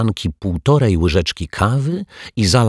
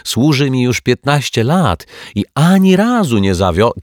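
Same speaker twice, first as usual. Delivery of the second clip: raised, loud assurance cut off mid-word; confident boast; 8 semitones higher, 6 dB louder than usual. A narrator's reading. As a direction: vouch for it emphatically, higher and louder. Detective boasts until chair breaks.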